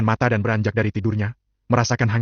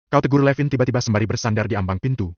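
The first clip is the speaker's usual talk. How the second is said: usual speaking voice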